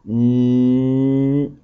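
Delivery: "Gne" said slowly with clear mouth shapes; gne sound. Shown as a demonstration. Teacher showing the class how to say "Gne", exaggerated mouth. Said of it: "En montrant les dents".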